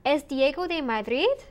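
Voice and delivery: "¿Es Diego de Madrid?" climbing sharply on Madrid; rising question intonation. Shown as a demonstration. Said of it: "I use the intonation to indicate that it's a question".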